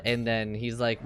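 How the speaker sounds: usual speaking voice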